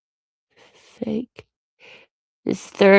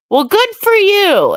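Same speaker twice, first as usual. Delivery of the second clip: very high, swooping mock congratulations; sarcastic; 11 semitones higher than usual. Real, not acted. Retort to someone with no bad angle, sarcastic. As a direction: pitch it way up, dripping with sarcasm.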